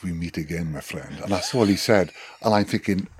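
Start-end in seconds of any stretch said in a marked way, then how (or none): 0.0-1.2 soft, ominous mock South African greeting; South African accent. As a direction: imitate a South African, dropping lower and quieter. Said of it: "not really good at doing a South African accent".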